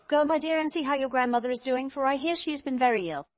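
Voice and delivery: mother's instruction. A narrator's reading. Mother sends daughter to see sick grandmother, motherly instruction.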